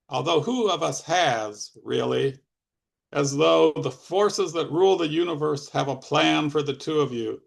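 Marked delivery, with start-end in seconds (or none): none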